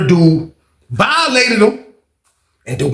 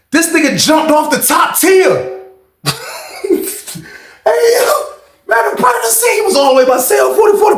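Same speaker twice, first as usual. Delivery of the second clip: much higher, pumped-up storytelling; excited retelling; 11 semitones higher than usual. Real, not acted. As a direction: blurt it out amazed. Excited telling of leap.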